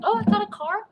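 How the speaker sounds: high, loud, excited squeal; delighted surprise. Acted. Daughter offered a car instead, squealing high. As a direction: shoot the pitch up in surprise.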